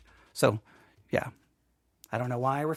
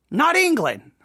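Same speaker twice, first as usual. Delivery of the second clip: high-pitched, playful correction; comic emphatic correction; 9 semitones higher, 4 dB louder than usual. Real, not acted. Host mocks his own geography mix-up, comically emphatic. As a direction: jump up high to underline your own mistake.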